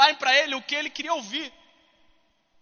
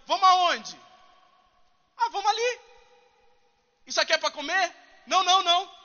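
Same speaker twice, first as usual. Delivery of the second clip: sarcastic; 5 semitones higher than usual. Retold or imitated. Sarcastic person imitated.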